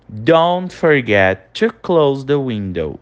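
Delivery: very slow, carefully spaced English for students to repeat; slow pronunciation model. Shown as a demonstration. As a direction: say each word slowly so the class can echo it. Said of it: "repete a pronúncia em voz alta". (leitura bem lenta para o aluno repetir)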